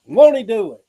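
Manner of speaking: high, loud call-out. Retold or imitated. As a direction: shout it out like a church call. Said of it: "they're like".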